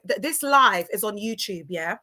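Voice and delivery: ordinary talk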